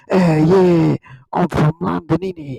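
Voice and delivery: caricatured Cockney Londoner; Cockney accent. Acted. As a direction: ham up a mock London Cockney. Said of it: "And they go like".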